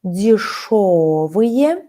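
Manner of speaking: дешёвые drawn out slowly with stress on шё; slow stress demo. Shown as a demonstration. Teacher models the full adjective for her student, slowly. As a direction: spell out дешёвые slowly, leaning on the second syllable. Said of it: "It's a full adjective".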